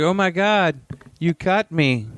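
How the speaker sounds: high, hammed-up exclamation; mock client voice. Co-host voices the exposed client, high mock voice. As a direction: play the client in a high put-on voice.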